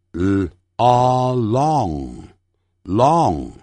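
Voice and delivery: l and a stretched, then blended into long; very slow, drawn-out long for repeating; sounding out. Shown as a demonstration. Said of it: "跟我念".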